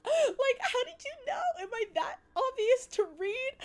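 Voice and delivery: very high, squeaky mock-crying voice; hammed-up tearful squeal; mock wail. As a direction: whine it way up high, comically exposed. Flustered at being figured out by commenter.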